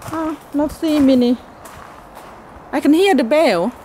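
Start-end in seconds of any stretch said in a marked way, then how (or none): none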